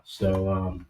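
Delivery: ordinary delivery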